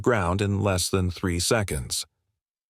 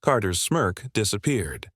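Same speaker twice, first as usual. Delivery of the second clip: ordinary delivery, nothing marked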